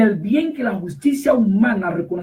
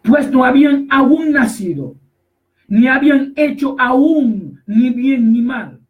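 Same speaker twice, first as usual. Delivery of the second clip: louder, measured scripture reading; lectura bíblica enfática; 4 semitones higher, 5 dB louder, slower than usual. Acted. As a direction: proclaim the verse, drawing out key words. Relee el versículo en voz alta. (lectura solemne y enfática del versículo)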